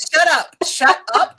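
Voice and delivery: high, laughing protest; laughing shut up. Real, not acted. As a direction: squeal "shut up" through laughter.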